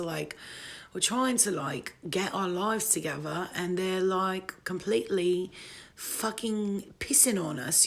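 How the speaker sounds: put-on ranting in a playful British accent. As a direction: complain like a fed-up Brit, British accent. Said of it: "I've been on my, my British English accent for a minute".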